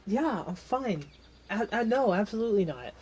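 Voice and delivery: lightly voiced, answering quickly; a hint of cousin reassuring him.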